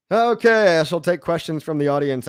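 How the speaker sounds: a cheerful call to the audience; enthusiastic okay. Real, not acted. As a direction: perk up for the Q and A. Shifting to audience questions.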